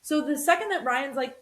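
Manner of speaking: ordinary talk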